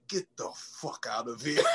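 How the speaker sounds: low and quiet, through a laugh; laughing retold brush-off. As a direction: quote the doorman while cracking up. Doorman throws reporter out.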